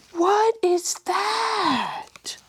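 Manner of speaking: high, slow breathy wonder; amazed whisper. A narrator's reading. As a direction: whisper it in awe. Reader amazed at picture.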